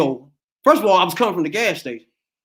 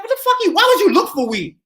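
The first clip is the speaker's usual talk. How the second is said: incredulous; 11 semitones higher than usual. Retold or imitated. Retold incredulous reply to cops.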